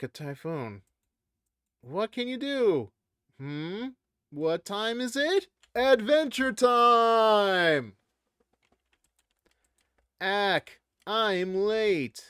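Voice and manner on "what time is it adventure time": loud, slow, drawn-out cartoon call; Adventure Time shout. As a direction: belt it like the show intro. Quoting Adventure Time intro.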